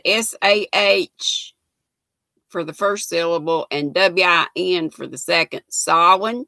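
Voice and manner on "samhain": "Samhain" said sah-win, rising like a question; "Samhain" said sow-en, unsure; hesitant "Samhain" as sah-win with a rise; trying Samhain. Shown as a demonstration. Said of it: "Does that sound right?".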